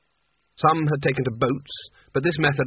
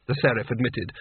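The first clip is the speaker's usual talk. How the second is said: usual speaking voice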